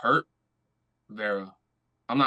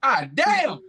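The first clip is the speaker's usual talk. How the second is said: amazed exclamation; 9 semitones higher, 7 dB louder than usual. Real, not acted. Reacting to weight reveal.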